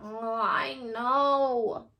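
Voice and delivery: slow, drawn-out moan; sick bunny moaning from his bed. A narrator's reading. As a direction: groan it out sickly. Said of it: "moaned Wilbur".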